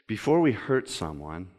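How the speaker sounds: slow quote reading with long, weighty pauses; measured, low-pitched and unhurried, each clause left to sink in. Real, not acted. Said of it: "I'm going to read it slow".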